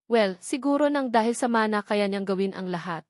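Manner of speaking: ordinary delivery, nothing marked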